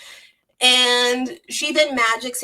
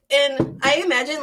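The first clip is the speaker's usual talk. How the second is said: ordinary talk, nothing marked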